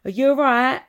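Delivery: loud, high casual greeting; hammed-up Essex hello; hearty greeting. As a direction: call it out brightly as a hello. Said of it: "I'll go".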